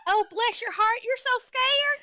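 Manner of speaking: high, sweet, pitying coo; sugary monster. Pretend. Co-host plays a sweet monster comforting scared prey, high and cooing. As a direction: pat the victim's head with your voice. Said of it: "just sweet voice?".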